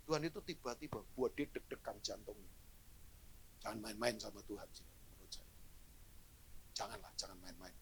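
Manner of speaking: low, earnest warning, stress on bercanda; solemn warning. Real, not acted. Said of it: "Saya ulangi". (peringatan serius, tekankan "bercanda")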